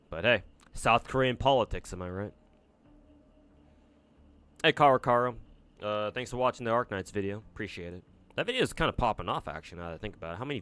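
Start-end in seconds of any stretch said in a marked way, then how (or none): none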